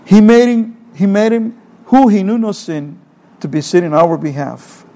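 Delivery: ordinary talk, nothing marked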